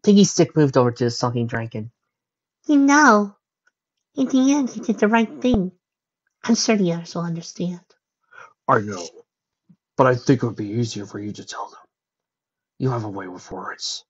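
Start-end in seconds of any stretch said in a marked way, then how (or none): 2.7-7.8 high, light little character voice; consoling candlestick. Acted. 8.7-14.0 low, subdued dragon voice; sulking dragon. Acted.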